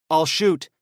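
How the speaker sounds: loud, firm warning; determined. Acted. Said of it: "she said with determination".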